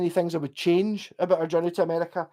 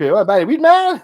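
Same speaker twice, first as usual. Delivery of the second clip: loud, higher-pitched imitation of a street shout; mock rapper shout; 6 semitones higher, 10 dB louder, slower than usual. Rapper on a New York street to tourist, mock shout. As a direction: call it out big, imitating a pushy rapper. Retelling rappers calling out to him.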